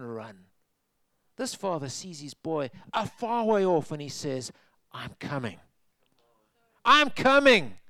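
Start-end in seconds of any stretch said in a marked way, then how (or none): 4.9-5.6 much softer, I'm coming breathed out; tender quiet call. Acted. Father spotting his son far off, soft and quiet. 6.8-7.8 higher and louder I'm coming, calling out; joyful shout. Acted. Father running to his son, calling out loud.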